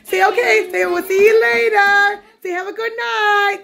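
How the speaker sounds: high, slow, sing-song prompting; drawn-out high mommy voice. Real, not acted. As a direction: coach a little one to say bye, stretching the words. Prompting baby to say goodbye.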